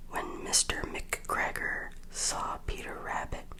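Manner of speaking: ordinary delivery, nothing marked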